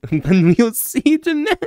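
high, loud, laughing French; breaking into laughter. Real, not acted. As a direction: lose it laughing while attempting the French. Breaks up over own French.

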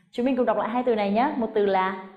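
ordinary delivery

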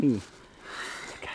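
slow, soft whisper of comfort. Acted. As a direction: breathe it gently to soothe him. Comforting a crying man.

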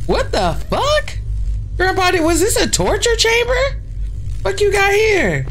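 shocked. Real, not acted. Finding torture chamber in basement.

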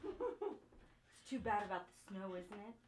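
smarmy voice. Acted. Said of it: "in a very smarmy voice".

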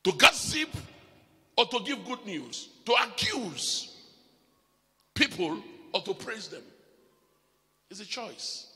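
rising, loud sermon voice; preaching. Acted. Preacher exhorting the congregation, loud and rising. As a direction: preach it out to the church.

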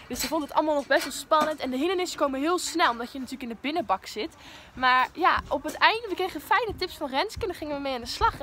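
usual speaking voice

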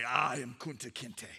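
low, slow declaration through exhaustion; defiant, battered. Acted. Beaten chief on the post to his whipper, defiant. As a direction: pull the words up with effort. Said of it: "he'd pull himself up on that post".

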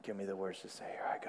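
whispered prayer to himself; quiet whispered prayer. Retold or imitated. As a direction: breathe the words as a secret prayer. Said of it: "he said quietly to himself".

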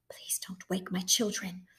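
soft whispered request; whispered plea. A narrator's reading. Mama pleading with the soldiers, whispering. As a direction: whisper it, pleading for the sleeping children.

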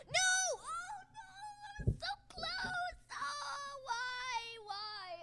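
high, drawn-out cry of despair; anguished wail. Real, not acted. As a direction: wail it like the win just slipped away. Losing a close round live.

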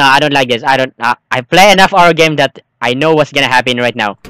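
normal talking voice

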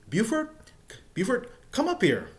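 high, loud call of a name; drill instructor calling. Retold or imitated. Drill instructor calling recruit up in barracks, high loud call. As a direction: summon him like a sergeant across the barracks.

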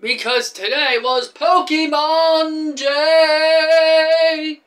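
high, drawn-out, loud hype announcement; sing-song excited shout. Real, not acted. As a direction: belt it out slow and high, stretching the last word. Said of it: "I am excited".

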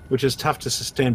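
normal talking voice

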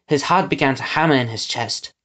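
ordinary delivery

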